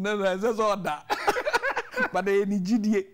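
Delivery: amused denial with a laugh; laughing, drawn-out no no; laughing protest. Real, not acted. Laughing reply.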